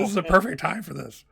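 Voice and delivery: high, loud playful protest; mock exasperation. Game master chiding Fish over the perfect moment, mock exasperated. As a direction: protest loudly as if let down, all in jest.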